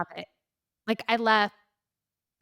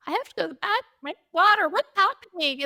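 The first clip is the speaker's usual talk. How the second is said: higher, louder, flustered protest; flustered panic; 9 semitones higher, 6 dB louder, faster than usual. Retold or imitated. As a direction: sound half-asleep and thrown into alarm. Woken suddenly, confused and panicked.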